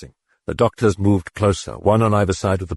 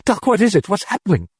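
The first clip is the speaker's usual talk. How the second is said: frightened; 13 semitones higher, faster than usual. Acted. Frightened mother asks doctor.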